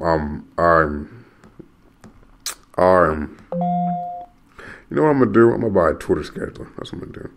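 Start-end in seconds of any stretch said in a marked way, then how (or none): none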